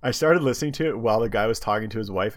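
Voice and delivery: usual speaking voice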